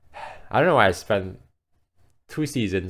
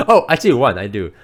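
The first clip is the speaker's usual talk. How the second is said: excited; 5 semitones higher, 11 dB louder than usual. Real, not acted. Excited discovery, much louder.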